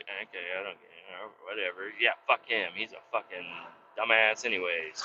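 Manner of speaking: low, soft, offhand voice; unbothered neighbor. Retold or imitated. Friendly neighbor accepting the chainsaw warning, quietly unbothered. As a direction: shrug it off under your voice. Acted other neighbor shrugging it off.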